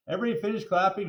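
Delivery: plain reading voice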